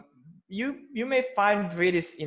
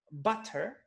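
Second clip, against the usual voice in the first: British "butter" with a crisp T; British accent; 3 semitones higher than usual. Shown as a demonstration. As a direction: pronounce the T in "butter", British style. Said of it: "So that's British".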